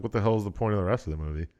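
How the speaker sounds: ordinary talk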